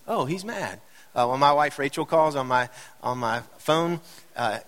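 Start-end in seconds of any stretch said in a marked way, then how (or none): 0.1-0.8 high, soft sing-song "uh-oh" tone; quietly clock that someone's in a mood, pitch swooping; wary realisation. Acted. Readers realise Paul is angry.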